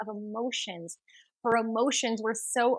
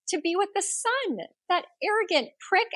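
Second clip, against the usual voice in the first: scornful family; 10 semitones higher than usual. Acted.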